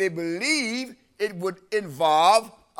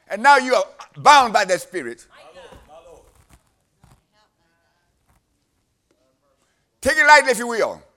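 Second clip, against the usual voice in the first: louder, higher preacher's cry, driving each line home; full-force preaching; 6 semitones higher, 6 dB louder than usual. Acted. Preacher warning the congregation about bondage, full-force preaching. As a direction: preach it hard and rising to the congregation.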